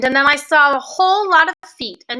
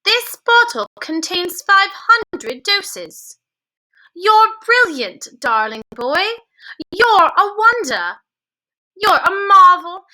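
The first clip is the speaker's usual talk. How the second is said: higher, delighted praise; delighted grandmother; 6 semitones higher, 3 dB louder than usual. A narrator's reading. Grandmother praising her grandson, delighted. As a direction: heap praise on the clever boy.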